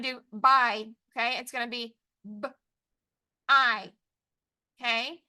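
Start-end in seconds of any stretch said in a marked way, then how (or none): 2.2-3.9 B, then a long I, sound by sound. Shown as a demonstration. Teacher showing right-handed tapping, sounded out. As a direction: tap out b-I with a clear gap. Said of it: "So we're gonna tap the word".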